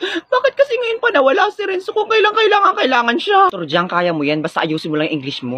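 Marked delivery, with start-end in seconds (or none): 0.0-3.5 high-pitched acted nervous panic. Pupil dreading the English quiz bee, nervous wail. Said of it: "I'm very nervous right now".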